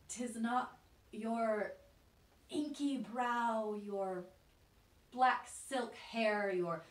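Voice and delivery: slow, loud, drawn-out mockery; mocking scorn. Listing the proud mistress's looks to reject her, mocking. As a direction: stretch each feature out with heavy emphasis.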